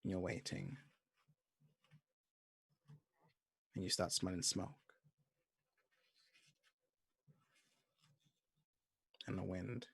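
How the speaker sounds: soft, halting suspense narration; game master scene setting. Acted. Game master leading a player toward smoke and blood, hushed suspense. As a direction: set the scene quietly with long pauses.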